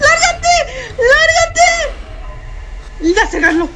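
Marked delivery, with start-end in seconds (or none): 0.1-1.7 panicked yell. Real, not acted. Shooing animatronic away.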